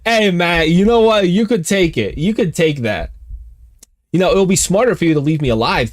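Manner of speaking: high, loud, urgent bargaining; high eager pleading. Real, not acted. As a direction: talk the cat out of it. Pleading with cat in-game.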